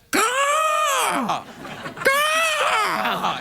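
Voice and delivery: high shouted bird caw; raven caw. Retold or imitated. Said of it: "Do you know a raven call".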